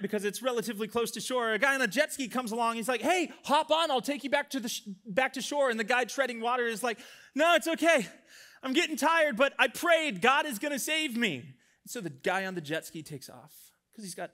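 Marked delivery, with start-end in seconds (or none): 3.0-5.7 high, raised jet-skier hail; acted helpful shout in a joke; calling out. Jet skier calls out to swimmer. 7.4-11.4 high, loud acted insistence; insistent refusal. Tired swimmer refuses second rescue.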